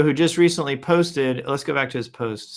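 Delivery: normal talking voice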